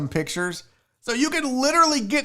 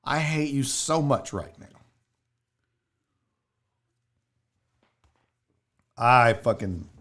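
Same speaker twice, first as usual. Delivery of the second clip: low, deadpan resentment; quietly fed up, pretend bitterness; mock hatred; 8 semitones lower, 3 dB quieter than usual. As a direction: drop low and grumble with playful loathing. Fed up with chat's puns.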